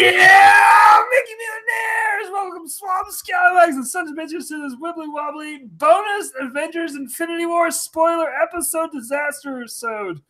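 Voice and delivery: high, strained yell easing off as it goes; long high-pitched announcer yell, dropping at the end; yelled show intro. Pretend. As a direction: holler the opener way up high, first word loudest. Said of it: "Just yell it".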